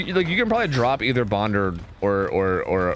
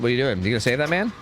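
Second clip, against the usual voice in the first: usual speaking voice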